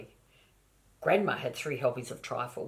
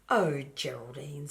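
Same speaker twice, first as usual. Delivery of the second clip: exasperated whisper. Acted. Mother sighing at child.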